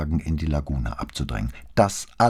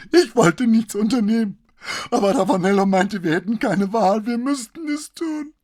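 hoch und gepresst vor Angst; in Panik alles herausstoßen; panisch, gepresst; 6 dB louder than usual. A narrator's reading. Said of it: "panischer Angst". (panische, hohe Stimme)

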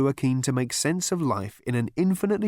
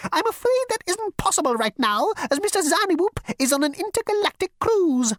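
high, fussy insect voice; insect receptionist; 3 dB louder than usual. Acted. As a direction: squeak it like a prissy bug.

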